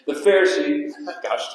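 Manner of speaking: real amused laughter breaking in; laughing at own stumble. Real, not acted. Preacher tripping over Pharisees mid-sermon, laughing.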